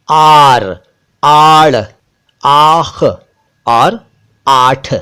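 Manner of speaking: आर, आड़, आख, आठ each set apart by pauses; one word at a time, clearly; word drill. Shown as a demonstration. Teacher listing new words for viewers. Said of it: "और शब्द देखिए".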